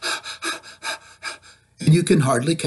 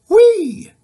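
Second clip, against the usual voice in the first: much higher, louder squeal of delight; gleeful whee; 8 dB louder than usual. Acted. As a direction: let it fly up like a slide ride. Letters racing up tree.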